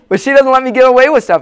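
talking through a real laugh. Real, not acted. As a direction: still laughing, getting the words out. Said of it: "[name] laughs".

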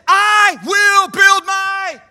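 I will build my shouted high and slow, hitting build; shouted proclamation. Shown as a demonstration. Preacher shouting Jesus' promise to the congregation. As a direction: proclaim it like a victory cry. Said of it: "Jesus said".

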